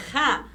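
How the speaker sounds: RA opening on a long breathy friction R; strong R. Shown as a demonstration. Teacher hinting at double R, strong R. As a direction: rasp the R before A in "Ra". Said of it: "produz um som forte".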